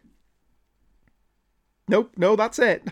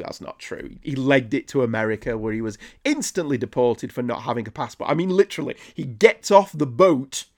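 ordinary delivery

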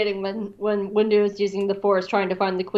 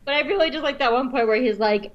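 ordinary talk, nothing marked

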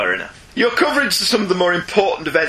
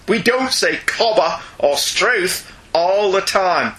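slow reading; slower than usual. Retold or imitated. Reading an Australian's email quote.

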